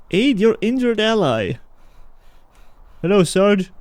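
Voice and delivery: ordinary talk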